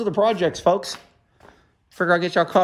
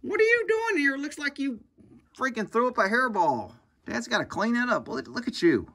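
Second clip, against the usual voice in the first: much higher, soft cat voice, indignant; voicing the cat; 8 semitones higher, 3 dB quieter than usual. Cat talking back to his owner who found his hiding spot, high and put-upon. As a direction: play the cat, annoyed at being found. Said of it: "He's like".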